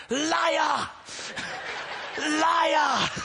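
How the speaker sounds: high screechy shout of accusation; Princess Bride heckler. Retold or imitated. Wife in the back heckling the preacher, shrieking. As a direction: shriek it like a movie hag heckling. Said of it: "She's like, ... The Princess Bride".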